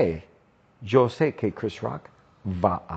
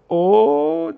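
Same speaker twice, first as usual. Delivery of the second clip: surprised oh; 9 semitones higher, 6 dB louder than usual. Acted. Gossip reaction in story lesson.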